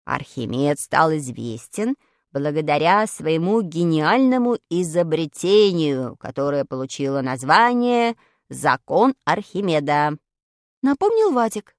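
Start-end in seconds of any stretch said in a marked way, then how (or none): 0.0-10.2 very low, slow character voice; Vadik explaining. Acted. Vadik reminding friends about Archimedes, low slow lecture. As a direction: recite it deep and measured.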